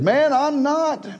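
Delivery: Peter denying. Acted. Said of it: "But Peter said".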